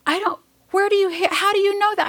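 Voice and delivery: high, fast disbelieving question; incredulous friend. Retold or imitated. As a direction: imitate a friend who can't believe it. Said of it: "They said".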